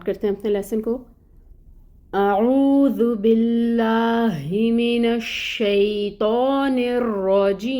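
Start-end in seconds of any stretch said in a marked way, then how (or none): 2.1-7.8 slow, drawn-out melodic tilawat; Quran recitation. Acted. Teacher opening the lesson for students, slow melodic recitation. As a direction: chant each word slowly with tajweed.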